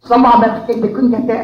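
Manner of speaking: ordinary delivery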